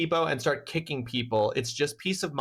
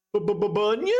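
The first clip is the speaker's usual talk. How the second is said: stuttering surprise; 7 semitones higher than usual. Acted. Stuttering at a startling name.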